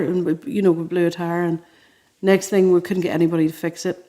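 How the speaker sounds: ordinary talk, nothing marked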